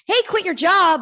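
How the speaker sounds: loud, high, punchy call-out; rallying call. Acted. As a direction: shout it like a recruiting slogan. Voicing her message as leader.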